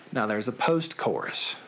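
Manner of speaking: usual speaking voice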